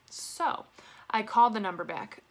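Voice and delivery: ordinary talk, nothing marked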